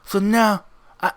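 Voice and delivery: quiet, formal crime boss addressing his follower; the Passion. Acted. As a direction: speak softly and deliberately, like a revered boss. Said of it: "So, the Passion looks at you, Richard, and goes".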